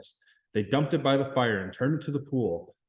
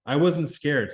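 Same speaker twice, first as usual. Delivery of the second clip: usual speaking voice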